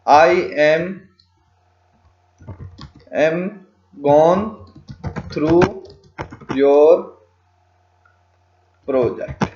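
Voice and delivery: one word at a time, long gaps between; halting speech paced to typing; slow dictation. Shown as a demonstration. Tutor types a suggested line for learners, slow word-by-word dictation.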